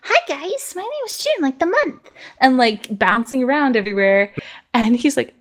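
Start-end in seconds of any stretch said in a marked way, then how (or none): 0.0-2.1 put-on tiny high bubbly little girl; itty bitty girl voice. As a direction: squeak it out like a tiny happy girl. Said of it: "this itty bitty little girl".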